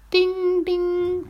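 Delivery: high ringing "ding"; high-pitched, drawn-out bell chime; imitated shop bell. A narrator's reading. Narrator mimics shop bells for listeners, high ringing chime.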